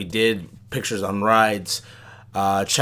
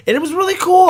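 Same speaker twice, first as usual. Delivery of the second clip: excited; 8 dB louder, faster than usual. Real, not acted. Excited about light-up cup.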